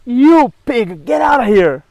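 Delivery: retold angry shove-off to Apollo. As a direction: throw him out in mock outrage. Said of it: "she got so mad".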